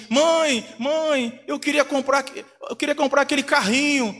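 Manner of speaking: a hint of a child pleading with mother. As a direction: imitate a child's high plea. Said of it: "Aí a criança fala assim".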